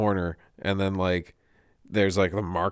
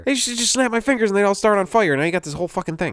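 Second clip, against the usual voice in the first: higher, louder mock rant; exasperated Satan; 10 semitones higher, 5 dB louder, faster than usual. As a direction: rant like a devil fed up with technology. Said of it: "I'm just picturing Satan with eyeglasses".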